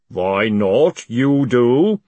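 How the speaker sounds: loud, heavy, deliberate retort; ponderous golem. A narrator's reading. Golem turns the accusation on Moist, ponderous. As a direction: drop each word like a stone. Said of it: "said the golem calmly".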